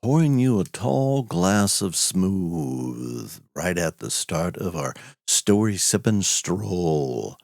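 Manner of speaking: honey-smooth radio host introducing the next tale; velvet radio voice. Acted. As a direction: croon it like a late-night velvet-voiced announcer. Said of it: "call him Velvet Voice Vernon, and you'll see why".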